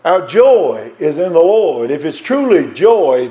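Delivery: ordinary talk, nothing marked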